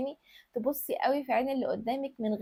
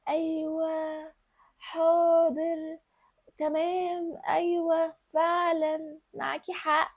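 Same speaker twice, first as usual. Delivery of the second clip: higher, slow coquettish words, leaning on each one; coquettish drawl; 4 semitones higher, much slower than usual. Shown as a demonstration. Coach shows women how to coo yes and right. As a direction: stretch each word sweetly like a pampered girl. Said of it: "تدلعي الكلام وانت بتكلميه وتتكي عليه".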